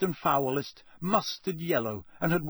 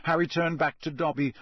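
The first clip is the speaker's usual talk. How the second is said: usual speaking voice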